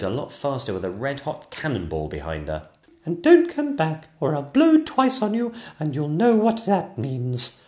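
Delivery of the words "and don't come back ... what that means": high, loud warning shout; shouting after her. Acted. Princess Sonia warning the fleeing witch, high and loud. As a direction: send her packing. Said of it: "shouted Princess Sonia".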